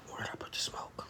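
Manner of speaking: hushed, voiceless muttering to self; barely audible whispered self-talk; whispering to self. Real, not acted. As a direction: whisper it absently while searching. Hunting for misplaced smoke alone.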